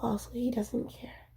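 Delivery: unvoiced whisper, holding back. Real, not acted. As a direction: whisper it so no one hears. Whispering venting alone.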